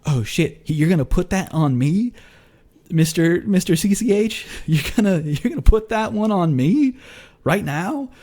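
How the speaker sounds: playful disbelief at being handed a secret; caught off guard. Retold or imitated. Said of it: "So now I'm like".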